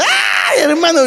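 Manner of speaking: sudden high wailing cry; a widow's anguished high cry to God. Retold or imitated. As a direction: break out in a sobbing high wail. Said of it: "se lanza y ora".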